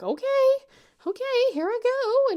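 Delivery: high, loud, panicky okay; nervous launch. Retold or imitated. Nervous mom about to jump off platform, high and panicky. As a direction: psych yourself up in a high scared voice.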